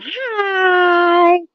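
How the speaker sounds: high, drawn-out mouse greeting; mouse saying hi. Acted. Recalling the mouse in the trash can, high drawn-out greeting. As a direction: stretch out a high-pitched mock hello. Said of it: "he was sitting there going".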